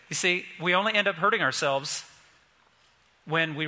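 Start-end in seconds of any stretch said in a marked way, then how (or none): none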